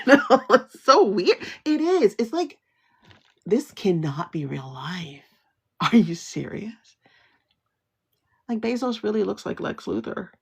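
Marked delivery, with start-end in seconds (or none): 3.4-7.0 slow, breathy whisper of disbelief. Real, not acted. Stunned by tech bosses, a slow whisper. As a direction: barely voice it, stunned.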